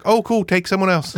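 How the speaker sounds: high breezy brush-off; mock-cheerful high refusal; dismissive wife. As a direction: wave him off lightly. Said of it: "And she's like".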